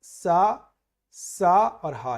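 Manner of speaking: teacher listing letters slowly; slow recitation. Shown as a demonstration. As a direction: draw out सा and हा very slowly. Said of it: "कहोगे सा".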